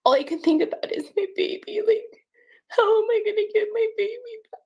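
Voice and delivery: tearful, high-pitched plea; real crying. Real, not acted. Mother pleading for her baby back, crying. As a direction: voice rising as you cry through it.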